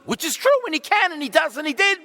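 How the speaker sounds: loud, high exclamation; booming amen-style affirmation; preaching burst. Real, not acted. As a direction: shout the affirmation to the church.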